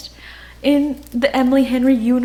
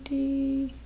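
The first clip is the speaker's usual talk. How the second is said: ordinary delivery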